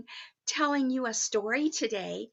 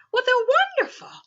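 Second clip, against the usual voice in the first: quick, high burst of delight; 10 semitones higher, 4 dB louder, faster than usual. Real, not acted. Storyteller praising the elf's pictures, quick and bright. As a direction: gush over it. Mrs. Claus gushes over pictures.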